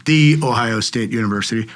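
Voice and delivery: "the" punched as its own emphatic word; stress on THE. Real, not acted. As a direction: lean hard on "the" before Ohio State. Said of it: "We're taught to say that".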